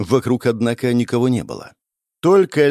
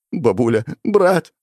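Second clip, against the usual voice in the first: raised, tearful cry for lost family; trembling tearful voice; 5 semitones higher, slower than usual. A narrator's reading. Crying demon boy calling his family, trembling voice. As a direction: call out through tears, voice trembling. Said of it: "говорил он дрожащим голосом".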